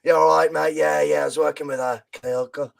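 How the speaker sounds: imitating a soft Japanese accent, lightly done; a light, gentle mock of a Japanese accent. Podcaster imitating the manager's soft Japanese accent. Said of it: "in that beautifully soft Japanese accent he uses".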